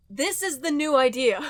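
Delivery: usual speaking voice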